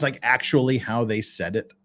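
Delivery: usual speaking voice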